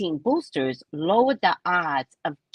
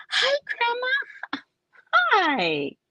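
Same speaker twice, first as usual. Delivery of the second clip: put-on high little-kid greeting; put-on cheerful kid squeal; happy grandchild; slower than usual. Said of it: "they're in a good mood".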